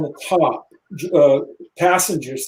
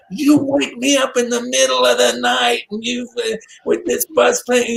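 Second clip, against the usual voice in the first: mock angry rant; 8 semitones higher than usual. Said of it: "he's so pissed off".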